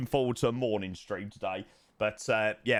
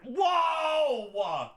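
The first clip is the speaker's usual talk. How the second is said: sudden whoa; 10 semitones higher than usual. Real, not acted. Sudden shock at screen.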